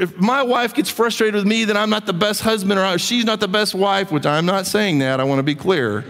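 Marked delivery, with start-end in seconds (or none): none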